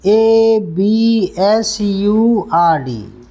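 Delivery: letters spaced slowly; slow spelling. Shown as a demonstration. Teacher spelling absurd slowly to students. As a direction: spell each letter deliberately.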